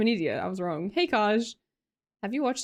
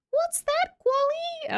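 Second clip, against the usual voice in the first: put-on much higher, sweet little-kid voice; a tiny child asking her aunt what that is; three-year-old niece; much slower than usual. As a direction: ask it like a curious toddler. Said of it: "my niece, who's three, was like".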